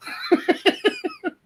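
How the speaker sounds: short high-pitched laugh. Real, not acted. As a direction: let out a quick, high chuckle. Laughs at own sad remark.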